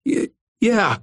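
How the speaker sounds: high, broken stammer; dangling bully stammering in fright. A narrator's reading. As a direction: choke out the word in panic. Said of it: "stammered the dangling brother".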